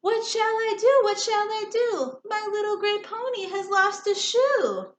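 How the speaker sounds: high, loud panicked cry; worried rider crying out to himself; man crying out. A narrator's reading. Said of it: "he cried out".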